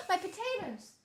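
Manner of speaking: high, gasping delight; gasp, then squeal the words; surprised gasp. Real, not acted. Gasps seeing her potatoes.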